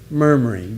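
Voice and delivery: murmuring said low and grumbling, like a discontented mutter; murmured demo. Shown as a demonstration. Preacher shows the congregation how murmuring sounds, low grumbling. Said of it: "Doesn't that sound like somebody who's unhappy?".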